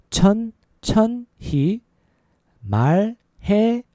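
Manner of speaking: each syllable separated and stretched; slow demonstration. Shown as a demonstration. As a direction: break it up syllable by syllable. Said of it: "A little faster".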